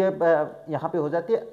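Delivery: usual speaking voice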